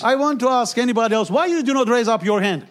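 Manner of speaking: loud, high, pressing and rousing; rally the room to answer; full-force preaching. Acted. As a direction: pastor urging the congregation to raise hands, preaching at full force. Preacher urging hands raised, chanting.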